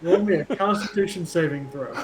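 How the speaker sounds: normal talking voice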